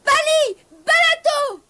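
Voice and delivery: high mock shout of the magic words; shouting as loud as he can. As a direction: yell the spell with all his might, pitched high. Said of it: "il cria le plus fort qu'il put".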